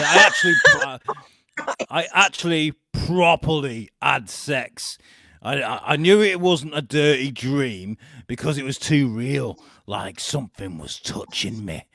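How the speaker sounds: loud, hammed-up Liverpudlian twang; Cheshire accent. Quoting reality star about her ghost, loud Cheshire accent. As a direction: declaim it in a put-on Cheshire accent. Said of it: "I was gonna say a bit Liverpudlian".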